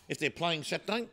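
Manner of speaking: ordinary delivery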